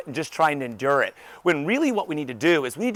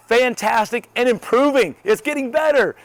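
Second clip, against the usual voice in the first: high, loud, beaming reply; punchy upbeat exclamation; enthusiastic; 7 semitones higher, 5 dB louder than usual. Acted. Answering people who ask how I am, enthusiastic. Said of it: "I'm gonna be enthusiastic".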